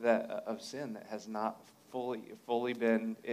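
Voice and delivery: ordinary delivery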